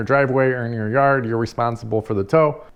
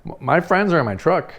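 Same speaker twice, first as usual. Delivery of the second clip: ordinary talk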